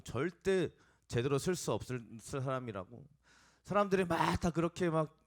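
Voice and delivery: loud, high preaching of the accusers' charges; fiery sermon on condemnation; forceful preaching. Acted. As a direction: build the sermon to full force.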